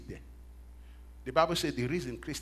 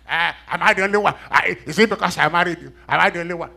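much louder, fed-up whine; exasperated complaint; 4 semitones higher, 12 dB louder than usual. Retold or imitated. Tired spouse protesting about the marriage, loud and fed up.

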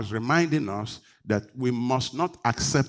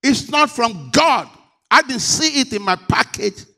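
emphatic refusal; 9 semitones higher, 6 dB louder than usual. Shown as a demonstration. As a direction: lean hard on each word. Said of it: "You got to be emphatic and say".

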